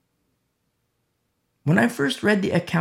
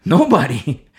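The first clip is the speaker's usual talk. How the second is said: triumphant answer; 8 dB louder than usual. Real, not acted. Triumphant answer to his question.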